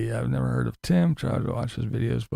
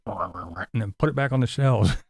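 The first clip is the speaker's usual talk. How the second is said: usual speaking voice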